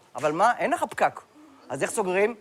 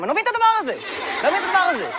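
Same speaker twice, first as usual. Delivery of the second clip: high-pitched comic bewilderment; mock exasperation; 9 semitones higher, much faster than usual. Comedian baffled by fruit flavours, in mock exasperation.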